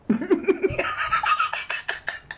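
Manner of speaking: high airy giggling fit; helpless giggles on the live. Real, not acted. Streamer losing it at the goat joke, giggling. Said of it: "I had the giggles".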